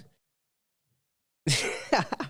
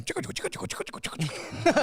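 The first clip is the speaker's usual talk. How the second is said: quick rhythmic whispery chicka noises; imitated chicka beat; 4 semitones higher, 7 dB quieter, faster than usual. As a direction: mimic a repetitive scratchy beat under the breath. Imitating repetitive music he was hearing.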